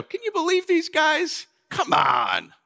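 high, scoffing shout of disbelief; mocking disbelief. Jesus to the crowd about John's disciples, mock scoffing shout. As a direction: sneer at the doubters, mock outrage. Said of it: "look at John's disciples and go".

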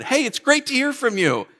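high, chirpy phone hello; over-cheery greeting. Retold or imitated. Greeting a friend who calls with bad news, obliviously cheery. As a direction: act out oblivious delight at a caller. Quoting his ill-judged greeting once more.

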